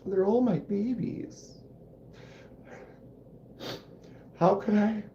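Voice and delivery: high, fond mock protest; doting. Real, not acted. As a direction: coo over them like babies. Doting on his knives.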